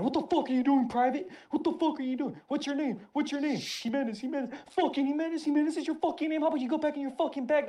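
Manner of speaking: screaming down at a recruit; drill sergeant shouting. Retold or imitated. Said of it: "She's shouting".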